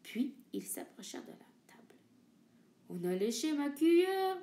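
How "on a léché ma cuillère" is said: louder, mock growly big bear; papa bear growling. Papa bear finds his spoon licked, mock growl. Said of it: "grogna papa ours avec sa grosse voix".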